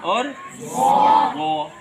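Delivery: very slow زو; letter name drawn out. Shown as a demonstration. Teacher spells Arabic letters for children. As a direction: stretch out زو clearly. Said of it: "الف با کی تختی میں".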